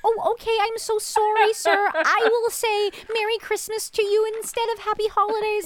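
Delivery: high, sugary apologetic shop-clerk voice; overly polite clerk. Retold or imitated. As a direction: pitch way up and grovel to the customer. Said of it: "in real life, I'd be like".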